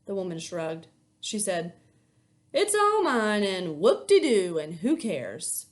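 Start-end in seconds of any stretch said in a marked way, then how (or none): 2.5-5.7 loud, scornful and dragged out; mock the whole thing, whoop-de-doo; sarcastic grumble. Bitter clerk, divorce settlement.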